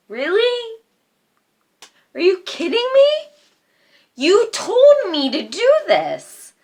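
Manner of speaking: mock outrage, voice shooting up; indignant high-pitched protest at her father; outraged disbelief.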